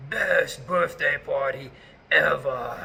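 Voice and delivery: slow, drawn-out dramatic ninja voice. Retold or imitated. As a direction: stretch each word like a mysterious ninja. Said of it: "like, ninja voice".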